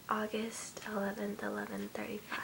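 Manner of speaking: soft whisper. Real, not acted. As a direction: whisper the date. Whispered reading of phone.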